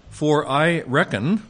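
sarcastic reading. Shown as a demonstration. Teacher reading Paul's words to the class, slow and mockingly sarcastic. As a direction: lean on "reckon" with a sly, sarcastic rise. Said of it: "a little bit of apostolic sarcasm".

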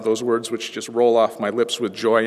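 ordinary talk, nothing marked

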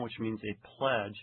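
usual speaking voice

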